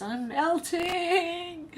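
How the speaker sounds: high, very slow wailing witch; Wicked Witch melting. Pretend. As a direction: stretch it out high like a dying witch. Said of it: "like the Wicked Witch of the West".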